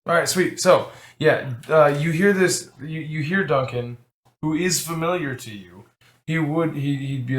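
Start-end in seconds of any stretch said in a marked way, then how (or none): none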